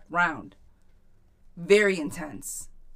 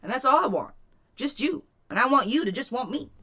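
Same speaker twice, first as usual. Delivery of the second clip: higher-pitched, heartfelt appeal; earnest plea; 6 semitones higher than usual. Acted. Coaching a client what to tell her man, earnest plea. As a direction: lay it out to a partner, pitched up. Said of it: "Tell him".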